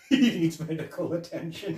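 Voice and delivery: lightly voiced, laughing through it; a hint of the cop's dry delivery; mock-serious report, half laughing; imitating the deadpan line. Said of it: "funny how he said that line".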